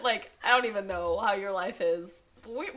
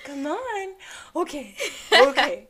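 higher, gentle coaxing coo; coaxing baby talk; 4 semitones higher, slower than usual. Retold or imitated. Parents coaxing a trapped bird out, gentle cooing.

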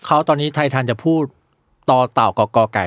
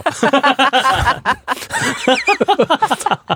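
long, high-pitched laughing fit; high giggling laugh that keeps going; 13 semitones higher than usual. Real, not acted. Laughs at the train news anecdote.

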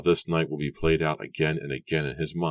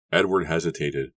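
usual speaking voice

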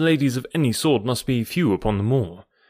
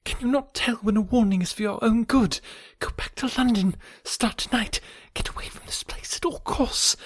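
much higher, fading into a desperate whisper; frantic warning; 9 semitones higher, 4 dB quieter than usual. A narrator's reading. Woman urgently warns visitor before her brother arrives, fading to whisper.

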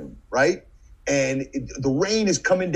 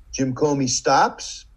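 ordinary talk, nothing marked

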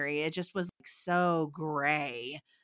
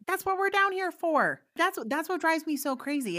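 fired up; 10 semitones higher than usual. Real, not acted. The host agreeing about spiritual bypassing, fired up. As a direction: burst in with real conviction.

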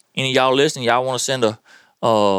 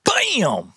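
imitated bam; 8 semitones higher than usual. Bricks hit Daddy.

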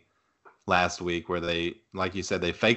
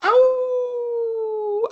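high wolf howl; 5 dB louder than usual. Real, not acted. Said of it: "I howled like a, like a wolf".

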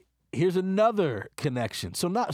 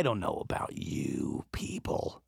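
conspiratorial whisper; 8 semitones lower, 6 dB quieter than usual. Acted.

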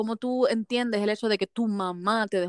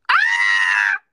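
shriek; 7 dB louder than usual. Real, not acted. Reacting to spoiler read.

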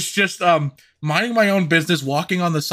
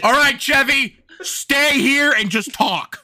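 higher, slow bossy order; mock commander; 7 semitones higher, slower than usual. Crew commander tells Chevy to stay and talk, slow mock order. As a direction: order him like a ship officer.

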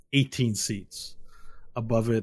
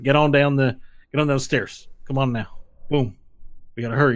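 raised, louder character voice; urging street-guy guide; 4 semitones higher, 7 dB louder than usual. Acted. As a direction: hurry them along as a street guy. Game master plays the guide.

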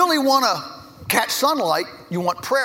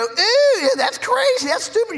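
put-on high, loud child's ew; kids disgusted; 13 semitones higher, 4 dB louder than usual. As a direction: react like grossed-out kids. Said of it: "And the kids go".

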